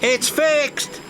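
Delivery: cry out. A narrator's reading. Signal engineer telling everyone the signal works, excited. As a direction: announce it with a light burst. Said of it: "cried out".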